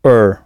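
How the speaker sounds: "er" as an English r-coloured vowel; English er sound. Shown as a demonstration. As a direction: isolate the sound "er". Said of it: "U R se prononce généralement".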